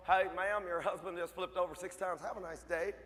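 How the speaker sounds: offhand, tailing off low; casual policeman. Retold or imitated. Policeman calling wife about crash, lightly voiced casual delivery.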